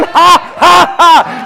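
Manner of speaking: high, staged "ha, ha, ha"; defiant mocking laugh. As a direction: laugh at the devil in punched ha's. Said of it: "Laugh at the devil".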